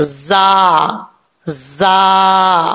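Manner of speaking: जा with a hissing, whistling z. Shown as a demonstration. Teacher demonstrating the whistled जा for students. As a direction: push air through the z of जा like a whistle. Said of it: "सीटी के साथ पढ़ना है".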